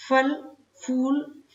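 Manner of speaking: फल, फूल said slowly, each word separate; slow model words. Shown as a demonstration. Teacher listing examples, says फल, फूल slowly. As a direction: space out फल and फूल slowly for the class. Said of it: "इस प्रकार".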